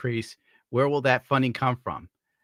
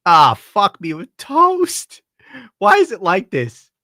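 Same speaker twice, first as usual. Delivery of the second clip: louder, higher, slow frustrated cry; exasperated outburst; 6 semitones higher, 8 dB louder, slower than usual. Real, not acted. Streamer failing to find a clip, loud exasperated outburst. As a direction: blurt it out, fed up with the search.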